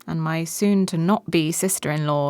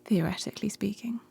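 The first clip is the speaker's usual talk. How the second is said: soft whispered echo; hushed echo of his words; 8 dB quieter than usual. Acted. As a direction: whisper it back, suggestive. Echoes in whisper.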